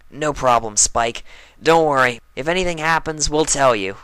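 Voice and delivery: loud, confident tomboy; bold, sporty filly shouting reassurance; brash tomboy voice. Acted. Brash friend reassures loudly.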